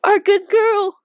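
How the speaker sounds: tearful. Real, not acted. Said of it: "I'm actually tearing".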